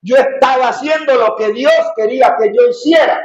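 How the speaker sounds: high, impassioned pulpit delivery; fervent preaching. Acted. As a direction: proclaim it fervently to the congregation. Preaches at full force.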